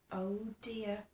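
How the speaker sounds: usual speaking voice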